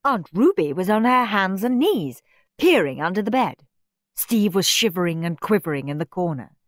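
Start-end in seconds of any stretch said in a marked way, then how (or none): none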